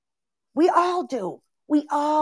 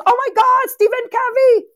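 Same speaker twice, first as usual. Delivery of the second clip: sudden high, loud burst of delight; a squeal of real excitement; excited exclamation; 13 semitones higher, 5 dB louder than usual. Real, not acted. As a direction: gush about it like a fan. Excited praise of the conditioning.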